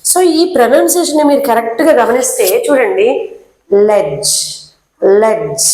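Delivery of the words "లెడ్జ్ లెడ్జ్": లెడ్జ్ said low as "lej", no d; soft d. Shown as a demonstration. Teacher modelling లెడ్జ్ with the silent d. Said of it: "దాన్ని మనం లెడ్జ్".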